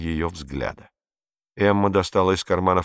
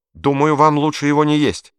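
put-on boy's urgent warning; 8 semitones higher, 6 dB louder than usual. A narrator's reading. Boy warning Hitler.

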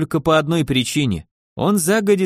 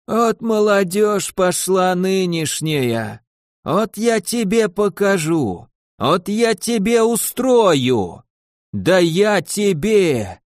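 slow, higher scolding granny voice; grumpy old woman; 4 semitones higher, much slower than usual. A narrator's reading. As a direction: scold like a furious old crone. Zombie old woman scolds gnome.

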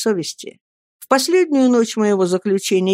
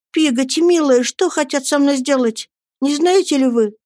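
put-on high-pitched anxious boy; pleading child; 7 semitones higher than usual. A narrator's reading. Boy asking nanny what will be done with him, anxious pleading. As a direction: plead for an answer, voice raised in pitch.

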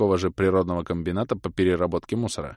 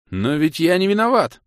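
protesting prisoner; 9 semitones higher, 7 dB louder than usual. A narrator's reading.

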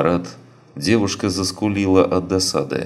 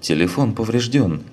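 bewildered; 6 semitones higher than usual. A narrator's reading. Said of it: "растерянно произнесла девушка".

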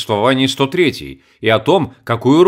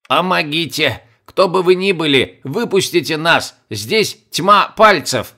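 high, slow, thin insect cry for help; tiny ant voice; 5 semitones higher, slower than usual. Acted. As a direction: squeak it out like a tiny trapped ant. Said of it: "муравей изрёк".

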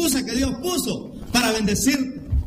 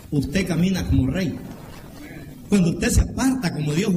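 ordinary talk, nothing marked

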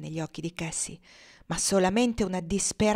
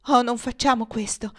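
anxious plea; 4 semitones higher, 4 dB louder than usual. Acted. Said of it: "esclamò Emelina".

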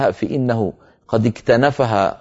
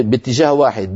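ordinary talk

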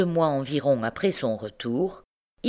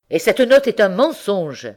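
loud, higher accusation; angry cry; 6 semitones higher, 7 dB louder than usual. A narrator's reading. Husband accusing the uncle, loud cry. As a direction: fling the accusation out. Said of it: "s'écria le mari de Bertrande".

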